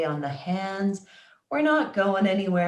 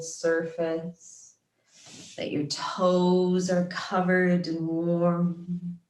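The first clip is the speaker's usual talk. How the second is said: measured, calm yoga nidra guidance, phrase by phrase; guided meditation. Acted. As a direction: guide them into relaxation, unhurried and steady. Leads guided relaxation practice.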